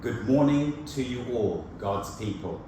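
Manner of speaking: ordinary delivery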